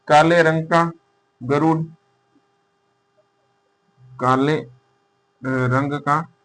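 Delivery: slow, spaced out for students to write; slow dictation. Shown as a demonstration. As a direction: read it out slowly as notes. Teacher dictating notes slowly. (धीमी गति से लिखवाना)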